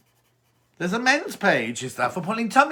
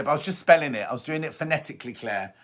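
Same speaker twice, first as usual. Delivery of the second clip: normal talking voice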